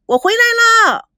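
high, loud happy call; calling out loudly. A narrator's reading. Girl calling grandparents loudly from the courtyard. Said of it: "大声地叫着".

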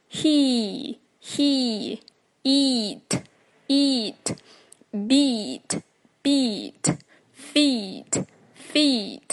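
he, eat, beat, feet with a long drawn-out "ee", falling; long ee drill. Shown as a demonstration. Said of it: "长一音要拉长".

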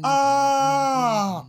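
high far-off scream fading; falling scream. Acted. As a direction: scream while falling away. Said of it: "转身跳了下去".

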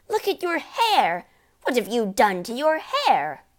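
loud, high shrieking mother; horrified shriek. A narrator's reading. Mother appalled by husband's hair, shrieking. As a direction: shriek in horror at the sight. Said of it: "the mother was shrieking".

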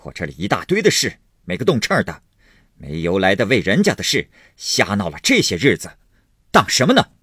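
scoff, then rail in bursts; angry yelling. Acted. Husband ranting about running errands for other people, angrily yelling. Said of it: "贾琏嚷道".

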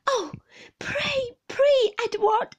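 high, loud, desperate plea; crying out. A narrator's reading. Mother begging her husband to stop, high anguished cry. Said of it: "cried my mother".

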